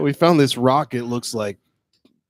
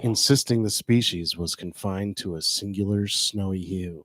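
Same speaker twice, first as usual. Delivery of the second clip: low, hushed documentary narrator; dramatic label reading; 5 semitones lower, 4 dB quieter than usual. Acted. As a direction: read the label like an epic tale. Reads the bottle label aloud.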